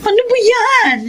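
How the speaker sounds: startled. Acted. Startled by door opening.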